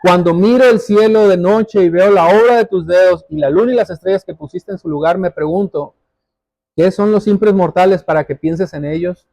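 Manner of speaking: normal talking voice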